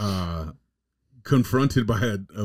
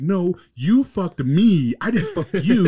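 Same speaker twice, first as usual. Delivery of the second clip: slow, higher mock wife, getting louder; mock wife insisting; 8 semitones higher, 5 dB louder, slower than usual. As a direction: drag it out high and loud like the insistent wife. Wife insisting in retold video.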